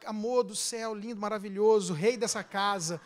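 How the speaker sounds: soft, coaxing endearments; butter him up gently, voice dropped; sweet-talking. Shown as a demonstration. Wife coaxing husband to fetch a box, soft sweet talk. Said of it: "você tem que aprender a falar".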